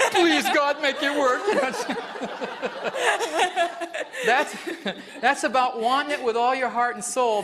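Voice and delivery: laughing high, mock plea to God. Real, not acted. Laughing at the volunteer's failed test. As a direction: laugh through a pretend prayer.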